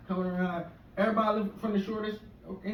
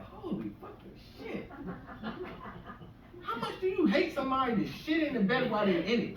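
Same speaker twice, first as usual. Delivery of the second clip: disbelief; 7 semitones higher, 4 dB quieter than usual. Retold or imitated. Comic reacting to the ex-wife's face, stunned disbelief. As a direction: gasp it out in horror. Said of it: "I'm like".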